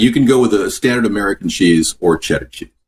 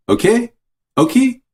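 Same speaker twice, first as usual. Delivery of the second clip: much higher, cheeky rising questions; squeaky teasing okie; playful squeak. Real, not acted. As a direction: toss it up high like a joke. Playful pun on okie dog.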